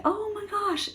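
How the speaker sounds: high-pitched, soft-volume squeal; excited, girlish-pitched exclamation; delighted gasp of reaction. Acted. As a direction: react like a thrilled mom. Acted parent's delighted reaction.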